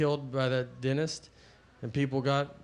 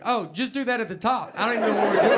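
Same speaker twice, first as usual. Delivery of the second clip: higher-pitched mock exasperation, louder at the end; exasperated parent; 6 semitones higher, 4 dB louder, faster than usual. Parent hits old math inside Common Core, exasperated. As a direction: give up on it, building in volume.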